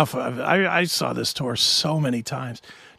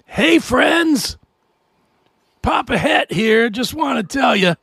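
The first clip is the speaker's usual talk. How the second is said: cheesy, upbeat stage announcement; mocking a reformed rock star; mock friendly frontman; 8 semitones higher, 5 dB louder than usual. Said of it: "is now the".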